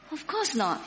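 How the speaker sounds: ordinary talk, nothing marked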